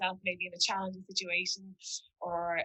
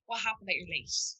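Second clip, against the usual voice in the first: softer, calm "What happened that you're late?"; calm curious question; 4 dB quieter than usual. Shown as a demonstration. To someone arriving late, a calm curious question. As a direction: ask with real curiosity.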